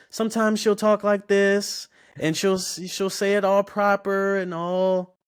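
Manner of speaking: high, slow, all-proper phone voice. Retold or imitated. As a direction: imitate mom's overly proper customer-service talk.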